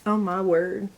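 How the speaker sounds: ordinary talk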